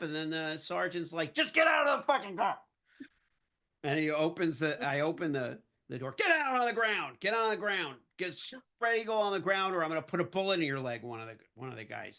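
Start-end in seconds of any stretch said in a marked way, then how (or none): 1.3-2.6 high, loud exasperated yell; pissed-off sergeant. Retold or imitated. Sergeant fed up with the driver, angry yell. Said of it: "they're just getting pissed off". 6.2-11.0 raised, urgent imitated orders, easing toward the end; screamed police commands. Officer threatening the man he's arresting, shouted orders. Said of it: "the screaming and the guns were enough".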